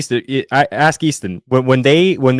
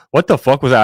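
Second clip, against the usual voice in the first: louder retold curse, a touch higher; mock loud fan; 4 semitones higher, 5 dB louder than usual. Big fan behind him repeating it, mild mock yell. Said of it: "this big guy behind me goes".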